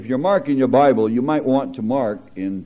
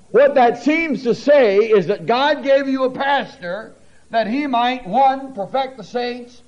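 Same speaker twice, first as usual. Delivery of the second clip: full-force preaching; 10 semitones higher than usual. Acted. Pastor preaches to the congregation about the carnal comma, fervently.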